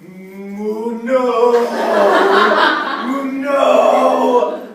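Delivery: loud, high mooing cry; loud high cow moo-cry; cow's cry. Acted. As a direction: wail it like a cow falling. Cow falling from branch.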